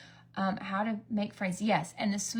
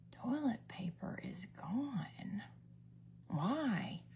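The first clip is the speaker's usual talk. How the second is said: slow, hushed disbelief; drawn-out, near-whispered incredulity; baffled echo; 11 dB quieter, much slower than usual. Retold or imitated. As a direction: repeat it softly as a bewildered question. Teller's baffled echo of sister's news.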